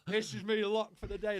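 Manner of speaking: cracking up while telling it; laughing. Real, not acted. Recalling stoner days, laughing.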